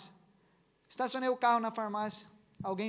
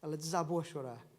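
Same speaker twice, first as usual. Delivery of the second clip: ordinary talk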